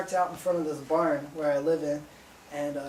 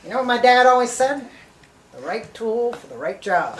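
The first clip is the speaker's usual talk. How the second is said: mocking boss's saying; 6 semitones higher, 11 dB louder than usual. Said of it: "he's giving me shit".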